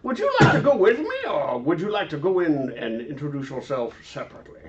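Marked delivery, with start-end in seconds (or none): 0.0-1.2 put-on old sage voice. Player voicing Deckard Cain.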